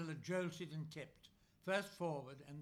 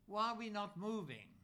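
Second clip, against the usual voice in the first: higher, a hint of a scared child asking; fretful frightened child; 6 semitones higher than usual. Frightened soldier asking his girlfriend, a touch of fretful child. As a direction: North Country-free, lightly childish fretful question. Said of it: "in the voice of a fretful, frightened child".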